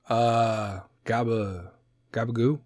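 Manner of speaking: mock-puzzled, questioning; baffled echo. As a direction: hesitate over the strange word. Acting baffled listener to Jersey offer.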